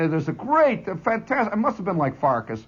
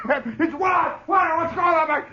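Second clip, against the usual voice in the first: mock shout; 12 semitones higher, slower than usual. Soldier shouting from crowd.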